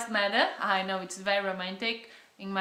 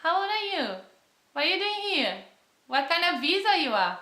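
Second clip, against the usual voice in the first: lightly mimicked eager questioning, pitched up; curious Australians; 6 semitones higher than usual. Imitating Australians quizzing a newcomer, bright and curious. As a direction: rattle off the locals' nosy questions. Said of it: "they ask".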